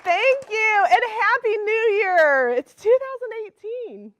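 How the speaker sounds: high, loud New Year cheer; excited greeting. Real, not acted. Host greeting the studio audience for New Year, excited. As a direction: call out to the crowd with excitement.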